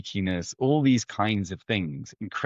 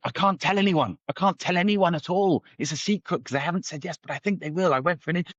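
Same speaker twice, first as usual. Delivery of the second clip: imitating a friend spilling news, high-pitched; high, tumbling confession, cut off mid-word; blurted secret; 9 semitones higher than usual. Friend blurting out secret job news over lunch, retold. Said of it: "it all came sort of blurting out".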